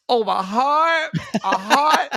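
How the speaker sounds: high, drawn-out mock swoon; hammed-up imitation of a lovestruck reaction. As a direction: gush over it, stretched and high. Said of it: "Ty's reaction when she said".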